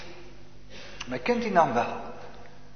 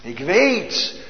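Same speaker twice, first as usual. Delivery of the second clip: ringing proclamation; 7 dB louder, slower than usual. Acted. Preacher proclaims certainty.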